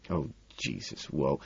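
low, very soft mutter. A narrator's reading. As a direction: mutter it under your breath. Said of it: "I mutter".

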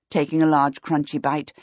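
plain reading voice

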